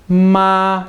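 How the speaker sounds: "ma" held long, high and level; steady first tone. Shown as a demonstration. Teacher showing the word for mom, high level tone. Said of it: "your voice just stays steady".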